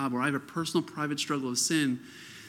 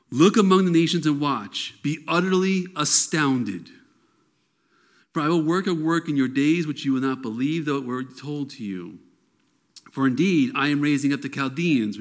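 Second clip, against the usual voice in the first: a louder, proclaiming reading of God's answer; scripture reading; 4 dB louder than usual. Acted. Preacher reading God's reply to Habakkuk, bold proclamation. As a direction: declare the verses boldly.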